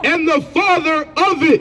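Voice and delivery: high, slow scripture reading; scripture declamation. Real, not acted. Reader proclaiming scripture at an opponent in debate, declamatory.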